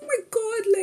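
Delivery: high, bright-eyed gush of delight; delighted squeal. Retold or imitated. Delighted squeal about the kind old officer.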